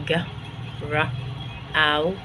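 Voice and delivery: "ج، ر، أو" as separate sounds with gaps; sounded out. Shown as a demonstration. Teacher spelling out ground, "ج، ر، أو" one sound at a time. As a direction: break the word into "ج", then "ر", then "أو". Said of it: "ننطق كده نستهجى".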